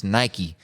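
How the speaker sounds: "Nike" with the final ee sounded; correct Nike pronunciation. Shown as a demonstration. Insisting to British listeners, "Nike" said as NY-kee. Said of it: "Start speaking English correctly".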